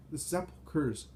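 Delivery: understated wailing exclamation; moaning. A narrator's reading. Frightened townspeople crying out about the tombs, moaning. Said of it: "Some moan".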